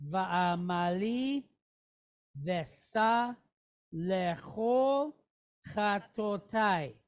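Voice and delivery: slow, word-by-word Hebrew reading; slow read-along. Shown as a demonstration. Teacher leading the class in reading Hebrew aloud, slow. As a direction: read it slowly so the class can follow. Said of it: "let's do it again".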